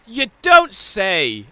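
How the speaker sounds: slow, loud mock surprise; sarcastic. Real, not acted. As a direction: drag it out dripping sarcasm. Sarcastic reply to friend.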